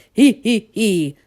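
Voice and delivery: helpless acted tittering; wheezing laugh. A narrator's reading. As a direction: wheeze out each little laugh. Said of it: "wheezed the dragon".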